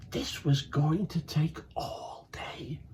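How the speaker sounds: slow, breathy whisper of dread; whispered despair. A narrator's reading. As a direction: sigh it out, hopeless. Narrator despairs at task.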